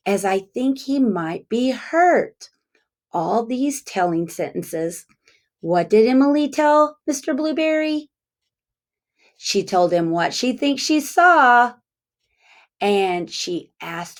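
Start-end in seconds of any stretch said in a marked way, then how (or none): none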